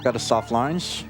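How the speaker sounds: usual speaking voice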